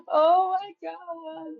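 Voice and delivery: high, slow words through real laughter; helpless laughing. Real, not acted. Reader cracking up at own drunk impression, helplessly amused. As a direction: laugh right through the exclamation. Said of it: "I shouldn't be laughing".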